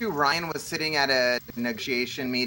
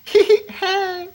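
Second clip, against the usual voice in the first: put-on anime girl voice; 5 dB louder than usual. Echoing the anime girl greeting.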